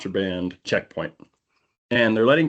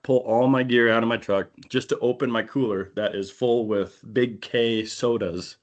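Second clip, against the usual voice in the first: normal talking voice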